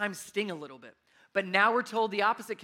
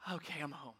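relieved sigh; 5 semitones lower, 14 dB quieter than usual. Retold or imitated. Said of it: "You're just like".

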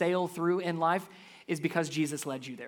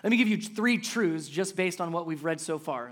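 normal talking voice

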